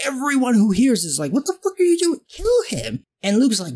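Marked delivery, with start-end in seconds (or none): 1.3-3.0 much higher, panicked outrage; a high, panicky chorus of disbelief; alarmed squeal. Retold or imitated. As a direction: shriek it in horrified disbelief. Everyone reacts in alarm.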